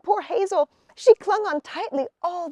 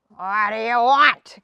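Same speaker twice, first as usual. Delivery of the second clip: louder, gruff angry shout; angry troll; 2 semitones lower, 7 dB louder than usual. A narrator's reading. Troll shouts at Hazel through the door, louder. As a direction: bark it from behind the door. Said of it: "came an angry voice".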